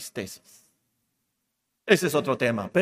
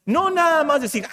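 ordinary talk

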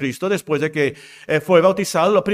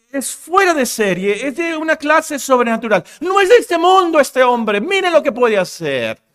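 rising fervor; 8 semitones higher, 5 dB louder than usual. Real, not acted. Preacher rising to climax.

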